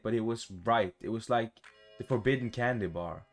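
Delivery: ordinary talk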